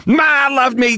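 put-on parodied New York accent; mock New York accent. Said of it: "Kevin Kline's New York accent is absolute garbage".

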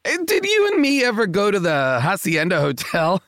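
usual speaking voice

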